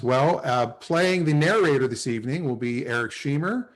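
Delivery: ordinary delivery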